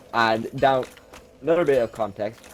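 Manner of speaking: normal talking voice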